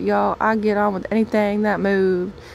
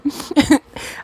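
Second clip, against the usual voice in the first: breathy laugh; 7 semitones higher, 6 dB louder than usual. Acted. Laugh after the outburst.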